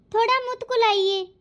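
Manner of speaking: lisped word मुतकुलाइए, with the s of मुस्कुराइए turned to t; childlike lisp on मुतकुलाइए; lisping bride. Acted. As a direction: lisp मुतकुलाइए like a shy bride who can't say her s sounds. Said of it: "ये तो तोतली है".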